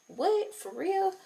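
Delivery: high, soft incredulous what; stunned disbelief. Retold or imitated. Watching her own fight video, high stunned disbelief. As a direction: gasp it in shock at the video. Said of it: "I had to watch it twice".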